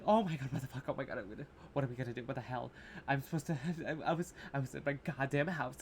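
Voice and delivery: hushed, jumbled panic babble; mock panic. Showing how panicked cursing sounds in a film, mock panic. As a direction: tumble through half-finished panicked phrases. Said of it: "'cause that's panic".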